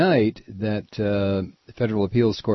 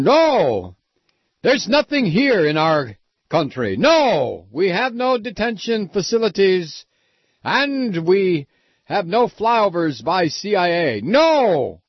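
hammed-up Eastern European accent, higher and loud; mock a Romanian official denying everything, high and loud; 8 semitones higher, 4 dB louder, slower than usual. Radio host mocks a Romanian official's denial in a hammed-up accent, high and loud.

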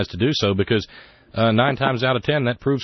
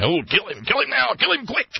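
high, mocking chant of kill him; mocking bloodthirsty quote; 11 semitones higher than usual. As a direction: sneer it like a lynch mob. Folks online calling for execution.

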